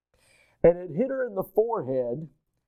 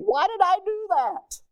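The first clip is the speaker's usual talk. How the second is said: high rhetorical question. Retold or imitated. Voicing his own guilty question.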